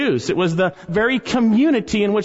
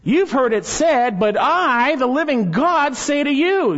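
rising sermon thunder voicing Jesus; commanding proclamation; 7 semitones higher than usual. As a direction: proclaim it as the living God.

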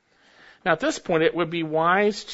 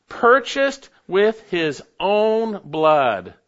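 slow, louder, leaning on "Purchased"; emphatic reading; 3 semitones higher, 5 dB louder, much slower than usual. Shown as a demonstration. Preacher reading Acts to the congregation, slow and forceful. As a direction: drag out "Purchased with his own blood". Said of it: "reading scripture emphatically".

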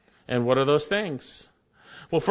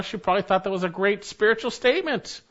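normal talking voice

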